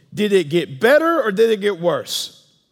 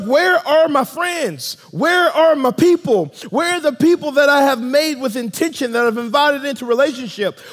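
raised, loud call to lost friends; a loving father shouting for his missing children; longing call, no condemnation; 6 semitones higher, 4 dB louder than usual. Acted. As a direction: call out for your people with longing, not anger. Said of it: "not calling them with a tone of condemnation".

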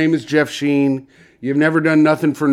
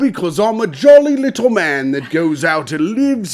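jolly Cockney chimney sweep, a touch louder; 5 semitones higher, 5 dB louder than usual. Acted. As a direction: play up a cheery London sweep. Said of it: "I came in talking like this".

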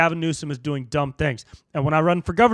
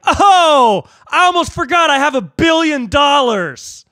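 high, loud, gleeful holler; mock-joyful shout; sudden realization; 10 semitones higher, 7 dB louder, slower than usual. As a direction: belt it out, stretching the last word. Acting out relieved blown-tire driver.

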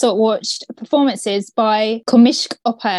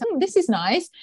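imitating the king; 6 semitones higher than usual. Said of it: "you could see them in the videos".

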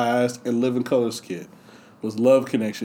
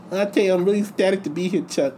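higher, quicker Tyson voice; Tyson impression; 5 semitones higher than usual. Tyson telling the host he's ecstatic, high and quick. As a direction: rattle it off as Tyson. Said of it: "Tyson answers host".

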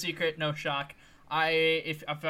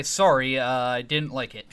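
put-on Canadian accent; 5 semitones lower, 6 dB louder than usual. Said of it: "And they'll say".

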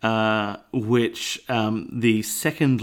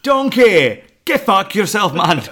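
yelled Scottish ogre, much higher and louder; aggressive Shrek; 8 semitones higher, 7 dB louder than usual. Acted. Shrek yelling at Donkey in a loud Scottish accent. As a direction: bark at Donkey like furious Shrek. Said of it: "aggressive Shrek".

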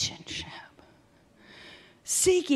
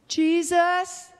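high, questioning call for someone; 11 semitones higher, 4 dB louder than usual. Acted. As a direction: call out as if checking who is there. Voices someone calling up the Lord.

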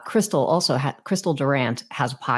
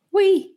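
We said much higher and louder, ringing out; emphatic title; 5 dB louder than usual. Shown as a demonstration. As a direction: punch the word We high and loud. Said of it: "like, a W E, not O U I".

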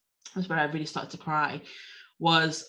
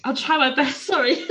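giggling apology, higher; laughing it off; 10 semitones higher, 3 dB louder than usual. Real, not acted. Laughing through sneezes.

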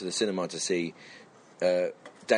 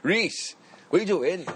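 higher-pitched mock dad calling to his son; 8 semitones higher, slower than usual. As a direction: call out as a dad walking in. Acting out dad arriving.

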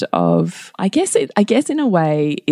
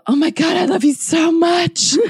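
higher, hammed-up lovey-dovey declaration; pretend gushing; 12 semitones higher than usual. Parents fake-smooching in front of their son, hammed-up gushing. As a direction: overdo the affection to embarrass him. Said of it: "like, pretend".

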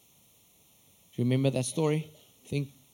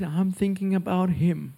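high, loud tearful retelling easing down; mock crying; 6 semitones higher, 5 dB louder, slower than usual. Imitating a crying friend passing on God's words, tearful. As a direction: imitate a tearful caller, coming down from the squeal. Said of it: "he's crying. He's like".

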